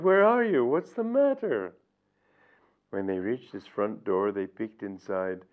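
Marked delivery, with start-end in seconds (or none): 0.0-1.7 very high, loud worried questions; anxious cry. Acted. Gopis searching for Krishna, anxious very high calls. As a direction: call out in alarm, searching. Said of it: "they cried".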